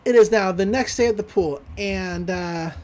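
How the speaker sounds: normal talking voice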